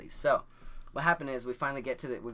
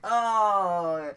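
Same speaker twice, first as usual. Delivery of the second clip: high, loud drawn-out mock "Oh"; a whole room gasping at once; shocked gasp; 9 semitones higher, 11 dB louder than usual. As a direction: class reacting in shock, hammed up high and loud. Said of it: "the teacher and all the kids are like".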